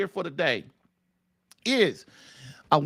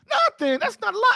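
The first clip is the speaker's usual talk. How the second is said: much higher, mocking echo; 12 semitones higher than usual. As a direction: throw the word back high in disbelief. Mocks husband's lying answer.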